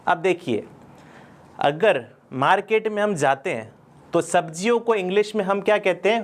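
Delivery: usual speaking voice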